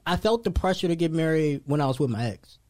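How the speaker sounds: ordinary delivery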